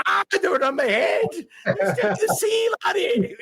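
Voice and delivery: high, laughing put-on Irish voice; cheeky high Irish brogue, slow at the sea line; mock Irish convict. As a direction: ham up an Irish rogue, laughing through it. Voicing the naked convict.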